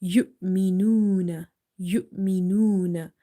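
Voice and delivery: Yu'minuna with the u drawn out; slow Yu'minuna, held vowels; stretched vowel. Shown as a demonstration. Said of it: "make them a stretch up to one alif".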